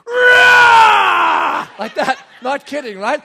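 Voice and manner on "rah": long, very loud high roar; imitated child's roar. Four-year-old facing the Tyrannosaurus Rex, fierce roar. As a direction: roar back at a monster like a small boy. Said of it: "going like this".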